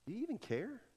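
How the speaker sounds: soft, hurt question to Jesus; hurt quiet plea. Retold or imitated. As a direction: ask it quietly, wounded. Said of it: "And they said".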